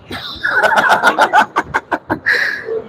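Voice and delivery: a high breathless laugh; amused laughter. Real, not acted. Laughing at Tinubu mockery.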